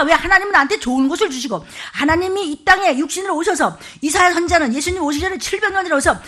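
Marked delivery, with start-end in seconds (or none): none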